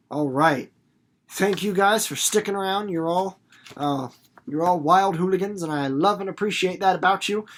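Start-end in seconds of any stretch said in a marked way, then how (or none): none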